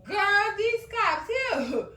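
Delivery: high indignant singsong; outraged singsong. Real, not acted. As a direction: sing it out in mock outrage. Outraged at threat to family.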